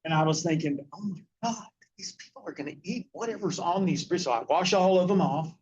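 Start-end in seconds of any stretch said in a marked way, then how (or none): 0.9-4.2 hushed inner panic. Retold or imitated. Inner panicked thought at brisket.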